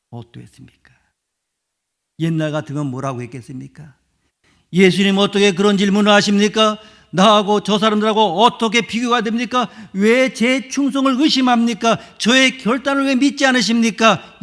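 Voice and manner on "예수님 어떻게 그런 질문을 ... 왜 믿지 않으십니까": loud, high, offended pushback; as if insulted by the question; indignant protest. Retold or imitated. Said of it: "이렇게 항변을 했을 것입니다". (억울한 듯 큰 소리로 항변하며)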